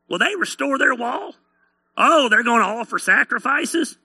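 mock them with incredulous hillbilly questions; hillbilly mocker. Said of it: "bunch of inbred hillbillies".

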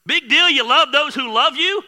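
big sarcastic preacher scoff; mocking scoff. Real, not acted. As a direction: throw it at them like a dare.

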